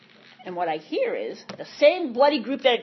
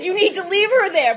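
higher and louder, insisting; insistent brother; 7 semitones higher, 6 dB louder than usual. Retold or imitated. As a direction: press the point like a worried brother.

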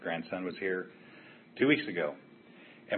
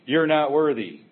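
much higher and louder, a grand proclaiming voice; booming slogan voice; 7 semitones higher, 10 dB louder than usual. Acted. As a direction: announce it like a mock decree. Reading T-shirt slogan aloud.

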